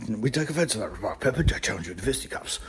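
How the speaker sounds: quick, low, soft mock challenge. Challenging Pepper in jest, quick low mock indignation. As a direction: toss off the challenge low and fast.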